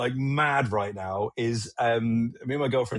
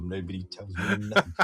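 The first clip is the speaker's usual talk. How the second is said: Bill Bailey line, laughing; 6 semitones lower, 3 dB quieter than usual. Retold or imitated. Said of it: "Bill Bailey's".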